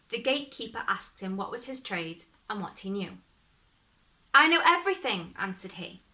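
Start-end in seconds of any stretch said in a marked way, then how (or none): 4.3-5.3 high, quick proud boast; cocky young hero brags to the watchman; boastful. Acted. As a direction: toss it off with pride.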